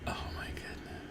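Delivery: breathless whisper of "Oh, my goodness"; whispered awe. Real, not acted. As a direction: whisper it, too stunned to voice it. Awed reaction after the scene.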